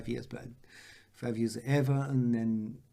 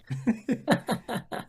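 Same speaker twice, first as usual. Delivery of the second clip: high burst of laughter; 12 semitones higher, 4 dB louder than usual. Real, not acted. Footballer amused by the debut whiskey story, laughing. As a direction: crack up at your own story.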